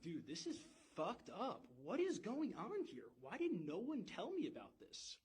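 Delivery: acted-out disbelief, pitch leaping up; shocked outrage. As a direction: retell it as a stunned, agitated outburst. Shocked student confronts the lecturer.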